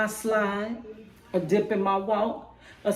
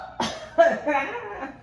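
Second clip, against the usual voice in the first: a real winded laugh after the poem; gasping laugh; breathless laugh; 7 semitones higher than usual. Real, not acted. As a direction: laugh while catching her breath. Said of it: "I'm out of breath".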